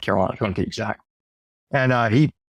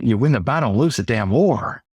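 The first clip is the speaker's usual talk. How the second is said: usual speaking voice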